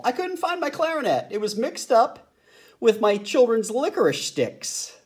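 high, light bird voice; high flamingo voice. A narrator's reading. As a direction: chirp in as a flamingo.

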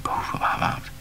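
ordinary delivery

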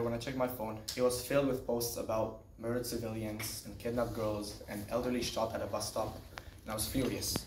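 ordinary talk, nothing marked

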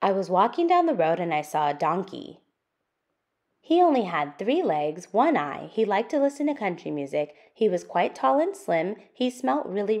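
lively, sing-song picture book reading; reading to children. Acted. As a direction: read it out for little listeners, building the list. Reads the picture book aloud.

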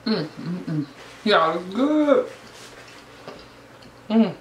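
mumbling between bites; talking while chewing. Real, not acted. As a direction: mouth partly full of food. Seen 2: Bites food at -7; lips pursed, jaw chewing throughout words.

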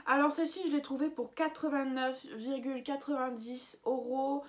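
ordinary delivery

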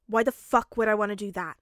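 loud, indignant question; irritated retort. Acted. Bakugo refusing to keep the mask, irritated. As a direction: bat the suggestion away loudly.

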